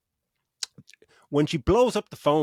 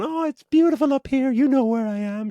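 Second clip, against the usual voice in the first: high, loud cooing on the phone; mock gushing daughter; 12 semitones higher, 6 dB louder than usual. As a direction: parody her sweet call home. Mocking heroine calling her mum.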